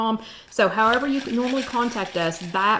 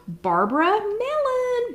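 gasp, then the name slow and much higher; excited announcement; 12 semitones higher, much slower than usual. Real, not acted. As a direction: announce the winner thrilled. Announcing giveaway winner.

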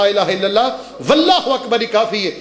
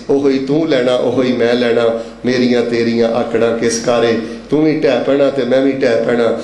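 low, measured poem delivery; low-pitched rhythmic verse; verse recitation; 6 semitones lower than usual. Real, not acted.

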